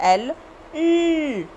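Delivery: एल, ल drawn out slowly, ल with the tongue behind the teeth; slow L demo. Shown as a demonstration. Teacher slowly modelling the L sound for kids. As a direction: stretch out ल slowly against the teeth. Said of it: "टंग को teeth के पीछे लगा के ल".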